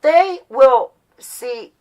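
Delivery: ordinary talk, nothing marked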